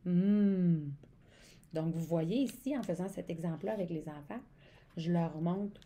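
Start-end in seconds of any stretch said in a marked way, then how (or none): 0.1-0.9 a long humming "M", louder; the M sound. Shown as a demonstration. Teacher to child Maxime, modelling the sound "M". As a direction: hum "M" with the lips closed. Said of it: "ça commence toi aussi par le son".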